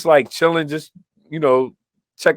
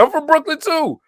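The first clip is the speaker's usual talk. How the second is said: high-pitched, eager imitation; retold excited kid; 13 semitones higher than usual. Quoting a new friend claiming the same hometown, excited high voice.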